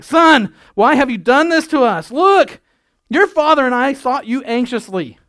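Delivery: high, loud frantic mother; frantic scolding mother. Shown as a demonstration. Pastor shows how Mary really scolded her lost son, frantic and loud. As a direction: shriek it like a parent who lost her kid. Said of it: "because I remember losing my kids".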